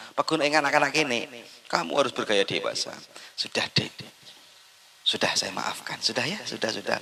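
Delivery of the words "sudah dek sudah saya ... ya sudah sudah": soft, whispered forgiveness; gentle soothing. Retold or imitated. Husband softly forgiving his wife, whispered. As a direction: hush her gently, forgiving.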